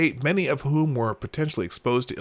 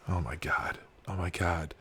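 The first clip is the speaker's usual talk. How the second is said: low, soft muttering to oneself; weary disbelief; 8 semitones lower, 11 dB quieter than usual. Real, not acted. Host to self after ranting at Jim, wearily exasperated. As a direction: sink down, drained by the stupidity.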